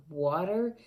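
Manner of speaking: ordinary delivery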